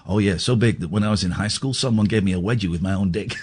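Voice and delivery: low, quick boastful brag; Leon impression. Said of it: "Leon goes".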